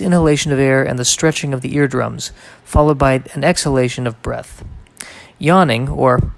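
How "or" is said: ordinary delivery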